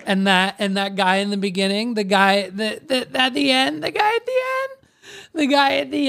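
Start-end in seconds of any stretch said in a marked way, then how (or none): 2.9-6.1 high, quick squeal; squeaky high voice. Real, not acted. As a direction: voice shooting up very high. Mimicking guy through squeaky laughter.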